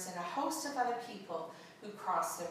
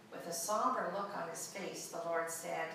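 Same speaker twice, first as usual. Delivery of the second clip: normal talking voice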